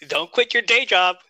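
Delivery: usual speaking voice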